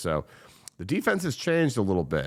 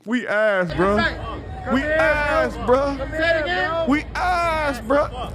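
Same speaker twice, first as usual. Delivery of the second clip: high, louder mock whine, said three times; mocking Isaiah Likely; 4 dB louder, much slower than usual. Fan mocking Isaiah Likely's on-camera complaint, high and loud. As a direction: lampoon a player moping about his team. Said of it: "same shit".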